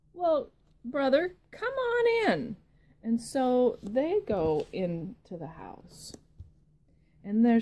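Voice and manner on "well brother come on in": high, light piggy welcome; pig brother voice. Acted. Brother pig welcoming his sibling, high pig voice. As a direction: invite him in in a high pig voice.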